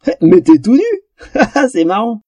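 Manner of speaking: high, drawn-out giggly astonishment; amused surprise. A narrator's reading. Elf sees the troll is naked, highly amused. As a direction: gasp it, then laugh merrily.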